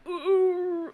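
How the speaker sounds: high mock groan of pain. Imitating a patient slipping off the bed, pained groan. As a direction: let out a loud, high "ooh".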